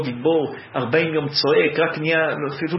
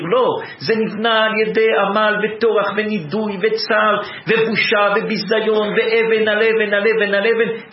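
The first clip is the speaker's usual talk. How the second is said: high, loud preaching fervor; fervent preaching; 5 semitones higher, 4 dB louder than usual. Acted. Preacher to congregation on David's kingdom, full-force preaching. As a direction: drive it home stone upon stone.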